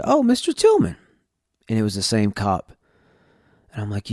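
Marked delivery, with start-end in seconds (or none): 0.0-1.0 imitating the cop's surprised greeting, much higher. The cop recognising him at the stop, mock-surprised and high. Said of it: "he said".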